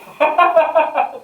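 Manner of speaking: loud, high-pitched laughing fit; burst of laughter. Real, not acted. Amused by the chat misunderstanding.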